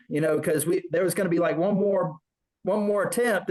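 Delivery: ordinary talk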